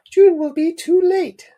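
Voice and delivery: very high, loud falsetto mum. Acted. Reader repeats Mum's line to Dad, high falsetto mum voice. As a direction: squeal it out in a put-on falsetto. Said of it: "I was doing a voice for the mum".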